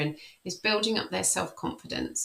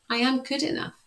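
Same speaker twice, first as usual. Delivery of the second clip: affirmation, pitched higher; 6 semitones higher than usual. Shown as a demonstration. Coach repeating affirmation for children, higher. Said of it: "you can say things like".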